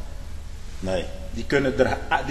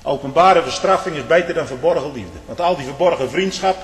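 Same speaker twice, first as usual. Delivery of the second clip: ordinary talk, nothing marked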